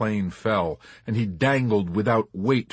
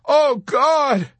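very high, loud scream; shrieking in terror; 8 dB louder than usual. A narrator's reading. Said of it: "he kept shrieking with no abatement of terror".

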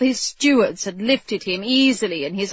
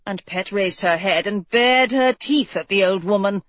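usual speaking voice

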